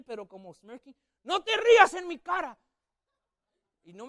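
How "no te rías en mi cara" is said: high, loud furious scolding; angry grandfather. Retold or imitated. Grandfather yelling at the laughing boy, high and loud. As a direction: yell it at the smirking kid.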